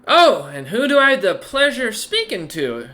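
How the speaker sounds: lilting lady rogue greeting a stranger; flirtatious woman rogue. Acted.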